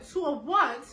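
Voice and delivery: loud high shocked two of what; stunned question. Retold or imitated. Teen mom asking the sonogram technician, loud stunned question. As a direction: blurt it out in disbelief.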